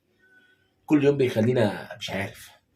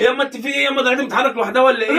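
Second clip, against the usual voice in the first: much higher, louder whining son; whiny son; 13 semitones higher, 6 dB louder than usual. Acted. Son to mother barred from home, high whiny plea. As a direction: whine at mom like a spoiled boy.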